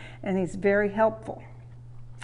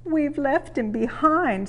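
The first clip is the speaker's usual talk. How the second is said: higher, slow mild mock sob; sobbing; 7 semitones higher, slower than usual. A narrator's reading. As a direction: lament it gently. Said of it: "sobbed Annie and Clarabelle".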